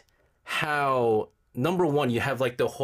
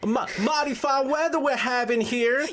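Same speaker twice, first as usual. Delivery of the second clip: high-pitched, hammed-up Southern accent; bad Southern accent; 11 semitones higher than usual. Host attempts Georgia talk, badly faked high Southern accent. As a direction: badly fake a folksy Southern farmer. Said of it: "Oh, no, he's bad".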